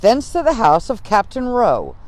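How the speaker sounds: lightly voiced General Washington; quoting Washington. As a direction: read Washington's words a touch grander. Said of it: "As Washington puts it".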